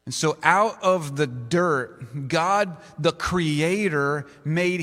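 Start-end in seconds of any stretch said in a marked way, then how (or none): none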